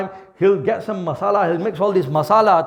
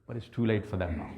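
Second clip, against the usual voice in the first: grave hush; 11 semitones lower, 13 dB quieter, faster than usual. Real, not acted. Grave warning, trailing off.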